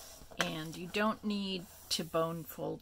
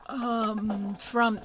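talking through a yawn; words stretched by a big yawn; 4 semitones higher, 9 dB louder than usual. Real, not acted. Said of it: "I'm yawning".

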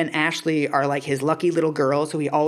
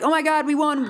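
high-pitched mock family excitement; excited win; 11 semitones higher than usual. Retelling a family's lotto win, excited. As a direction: squeal it lightly, like a retold jackpot win.

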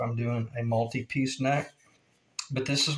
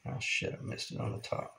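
breathy whisper under his breath, much softer; real slip, whispered to himself; whispered curse; 9 dB quieter than usual. Real, not acted. Woodworker spotting his own mistake, whispering under his breath. Notices a mistake mid-video.

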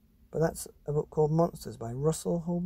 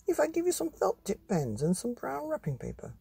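much higher, sing-song doctor voice; coaxing doctor; 12 semitones higher than usual. Acted. Doctor asking the boy to finish drawing, high coaxing. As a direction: coax the boy gently like a kindly doctor. Doctor Plunger coaxes John.

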